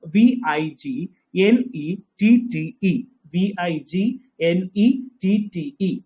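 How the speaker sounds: each letter said slowly and separately; teacher spelling out the word slowly. Shown as a demonstration. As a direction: dictate letter by letter. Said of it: "ശരിക്കും spelling".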